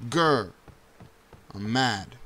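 ordinary talk, nothing marked

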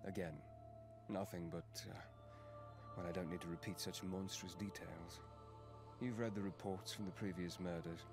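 low, quiet and strained; voice catching. Acted. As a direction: hold back grief, quietly. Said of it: "Their voice catches".

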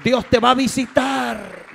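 "Dios te va a visitar" at full sermon voice, firm and falling; preaching voice. Acted. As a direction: proclaim it to the whole church. Second take, opening preaching.

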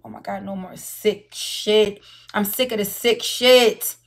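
slow, heavy fed-up words; slow exasperated complaint; fed-up drawl. Real, not acted. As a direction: lean on each word, disgusted. Slow disgusted emphasis.

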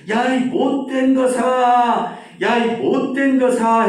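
높고 크게, 아주 느리게 외치는 아이; 산 너머까지 들리게 고함을 길게 뽑아; 산에 대고 소리침. Acted. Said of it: "내가 소리를 질렀거든". (산에 대고 외치는 아이처럼 높고 큰 고함)

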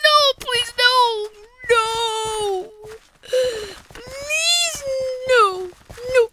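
high, whimpering plea; desperate pleading. Real, not acted. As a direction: beg in a high, whiny cry. Pleading while about to lose.

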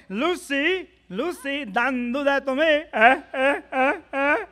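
put-on high, loud Cuban-accented bandleader voice; Ricky Ricardo. Comic to crazy Louis, loud Cuban-accented Ricky Ricardo. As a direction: call for Lucy in a big Cuban accent. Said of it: "What about Ricky Ricardo".

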